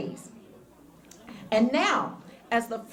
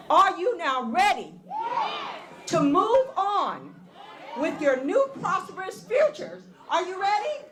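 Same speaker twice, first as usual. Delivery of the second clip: building hype; 5 semitones higher than usual. Real, not acted. Hyping graduates, asking repeatedly.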